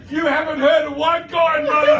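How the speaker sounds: loud, high mock shout; hammed-up yell at a doubter; excited retort. Recruit answers doubting mother, high excited mock shout.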